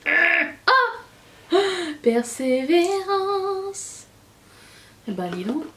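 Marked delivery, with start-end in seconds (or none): 0.1-4.1 high, drawn-out triumphant cry. Real, not acted. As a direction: stretch it out in triumph. Finally opening it after struggle.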